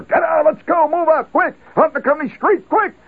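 high, loud drill sergeant yell; barking sergeant. Retold or imitated. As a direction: roust the men out like a frantic sergeant. Sergeant rousing the barracks.

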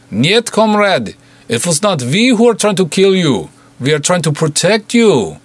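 put-on a Russian goon bargaining in thick Russian accent; heavy Russian accent. A narrator's reading. Russian goon calling through the door, heavy Russian accent.

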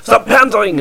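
high-pitched mock foreign outburst, vaguely French; muddled French-sounding accent. Imagined local telling off a pandering tourist, botched French-ish accent. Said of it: "It sounded French, but it didn't make sense".

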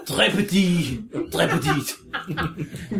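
thick hammed-up French accent; overdone French accent. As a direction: lay on a silly French accent. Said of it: "in an outrageous accent".

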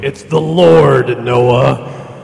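slow, loud booming voice from above; God voice. Pretend. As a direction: boom down like God from the sky. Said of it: "can you do the whole, uh, God voice?".

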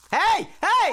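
loud high yell of protest; shouting. Real, not acted. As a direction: yell it out in alarm. Contestant yells at a false start.

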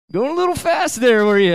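a lightly voiced cop needling the driver; teasing officer. Officer questioning speeding driver.

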